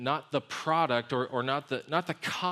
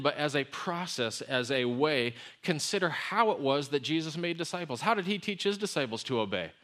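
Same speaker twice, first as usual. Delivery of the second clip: ordinary talk, nothing marked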